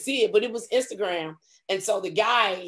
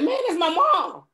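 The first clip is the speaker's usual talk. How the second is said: protesting son; 10 semitones higher, 4 dB louder than usual. Retold or imitated. Voices son's protest.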